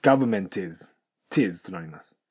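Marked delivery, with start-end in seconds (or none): 0.0-1.0 "government is" with the T said fully, linked as "tis"; T sounded. Shown as a demonstration. Teacher contrasting the fully sounded T. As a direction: say the T and run it into "is". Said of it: "ちゃんとTを発音する".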